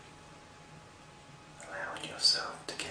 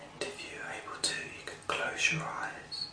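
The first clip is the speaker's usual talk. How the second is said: whisper hypnosis; 10 semitones higher, 4 dB louder than usual. Real, not acted. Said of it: "this is a whisper session".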